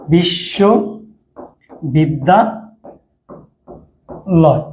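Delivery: বিশ্ববিদ্যালয় extremely slowly, in chunks with long gaps; syllables drawn apart. Shown as a demonstration. Teacher writing the word in Bangla for students, slow separated chunks. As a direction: spell out বিশ্ববিদ্যালয় by sound, part after part.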